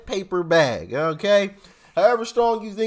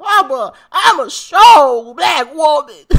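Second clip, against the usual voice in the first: louder, higher, slow mock proclamation; imitating Maxine Waters; 8 semitones higher, 10 dB louder, slower than usual. Vlogger mocks Maxine Waters declaring strength, loud and slow. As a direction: belt it out like a rally boast. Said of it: "Her voice is just so terrible".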